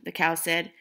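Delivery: plain reading voice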